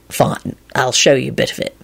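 low, reluctant agreement; sighing, resigned. A narrator's reading. Lupin giving in to Quentin, resigned. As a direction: give way as if with a sigh. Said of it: "he responded with a sigh".

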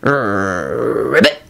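low, croaked ribbit; croaking toad. A narrator's reading. Trevor the toad answering Neville, low croak. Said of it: "he croaked".

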